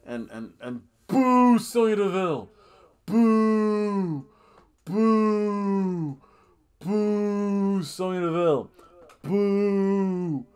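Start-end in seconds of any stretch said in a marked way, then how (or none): 1.1-10.5 high, long drawn-out heckling; hammed-up, relentless jeering; loud booing. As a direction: boo the villain like a crowd. Jeering a wrestler.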